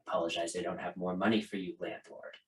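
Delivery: low, soft dad voice; quietly apologetic father. Acted. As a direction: hand it over with a quiet apology. Father hands over money.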